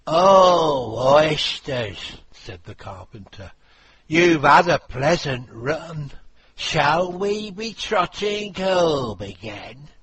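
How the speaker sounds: loud carpenter call. Acted. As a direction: call the oysters home. Carpenter calling oysters.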